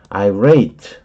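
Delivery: irate with the weight on rate, eye-RATE, not on the i; stress on the second syllable. Shown as a demonstration. Teacher showing where the stress falls, eye-RATE. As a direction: keep the i light and lean on rate in irate. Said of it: "late의 강세가 있어요".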